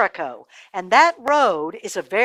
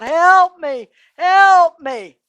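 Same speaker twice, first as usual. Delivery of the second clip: high, loud cry for help; 7 semitones higher, 9 dB louder, much slower than usual. A narrator's reading. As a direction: shout it out like a man dying on the road. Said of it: "So he cries out".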